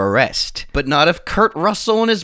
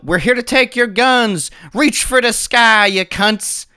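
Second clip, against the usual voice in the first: high, louder mock-tough demand; tough lawmen; 9 semitones higher, 4 dB louder, slower than usual. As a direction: ham up a cowboy showdown line. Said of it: "They go".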